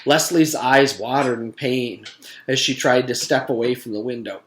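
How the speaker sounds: ordinary delivery, nothing marked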